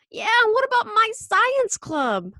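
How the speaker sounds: high, loud protest; indignant child. Acted. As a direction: chime in loudly with a high child's complaint. Michael protesting.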